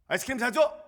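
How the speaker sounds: put-on high, loud demand of a small boy; confident child demand. Little son demanding ice cream from his father, high bold demand. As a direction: ask for ice cream like it's owed. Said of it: "너무 당당해".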